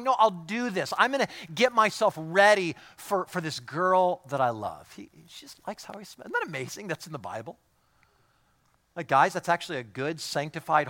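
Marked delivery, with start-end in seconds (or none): 0.0-4.9 eager husband. Retold or imitated. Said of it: "he's just going".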